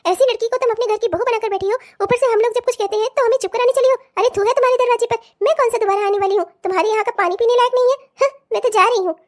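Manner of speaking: usual speaking voice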